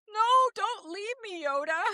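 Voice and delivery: high, slow, whiny plea to a mentor; hammed-up wail, begging him not to go; mock pleading.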